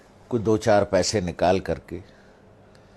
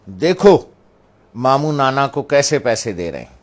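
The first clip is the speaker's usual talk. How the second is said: loud, high call to grandson; raised, scolding voice of a mother; stern mother imitation; 4 semitones higher, 9 dB louder than usual. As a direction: imitate mother loudly calling out a culprit. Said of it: "اپنے نواسے سے کہا".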